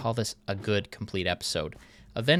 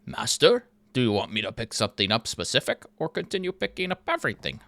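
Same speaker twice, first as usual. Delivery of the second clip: fast, strange servile robot; imitating the golem's voice; robot servant voice; 3 dB louder, faster than usual. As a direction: rattle it off like an eager golem. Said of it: "that's exactly how he sounds".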